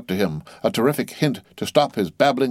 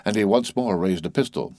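usual speaking voice

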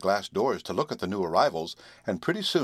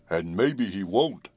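lightly voiced slow horse; slow refusal in a horse's voice; horse character; slower than usual. A narrator's reading. Horse refusing the girl's suggestion, in his slow voice.

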